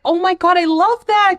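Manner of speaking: high-pitched imitation of delighted approval; put-on excited squeal of a voice; mock excited gushing. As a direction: gush it high, as if suddenly won over. Acts out Millie coming back around.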